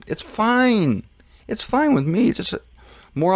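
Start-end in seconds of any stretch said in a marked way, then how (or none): 0.1-2.3 drawn-out, lilting high FINE; breezy acceptance. Real, not acted. Host accepting September twenty-third passing, breezy. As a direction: let FINE swoop up high, slowly. Shrugging off a missed date.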